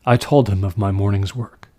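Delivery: ordinary delivery, nothing marked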